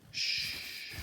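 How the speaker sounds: whispered shush. Acted. Susan soothes screaming Ada in the shelter, whispered shush. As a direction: hush her gently, all breath.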